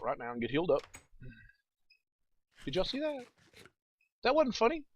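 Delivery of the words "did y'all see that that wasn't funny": high-pitched, rattled complaint; shaken protest. Real, not acted. Player complaining to viewers after a scare, rattled protest.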